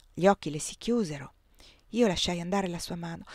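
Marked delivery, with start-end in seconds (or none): none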